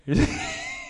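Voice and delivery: a sudden high-pitched burst of laughter. Real, not acted. Preacher laughs at Haman's humiliation, high laugh.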